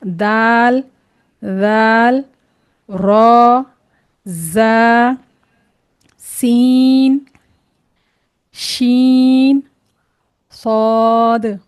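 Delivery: each letter drawn out and held, pauses between; reading out letters. Shown as a demonstration. Teacher reads the letters again for learners, slow held recitation. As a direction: recite the Arabic letters one by one, very slowly. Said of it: "फिर से मैं पढ़ती हूँ".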